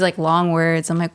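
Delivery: normal talking voice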